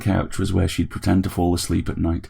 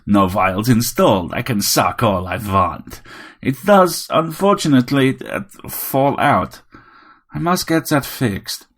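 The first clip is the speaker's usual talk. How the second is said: a tiny robot playing a grand vampire; robot Dracula; 5 semitones higher, slower than usual. A narrator's reading. Vampire robot telling Harry it wants all his blood.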